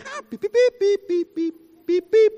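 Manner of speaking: high beeping horn noise; mock car horn. Preacher imitating a driver's horn in traffic. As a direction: honk like an angry driver. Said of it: "being cut off and going".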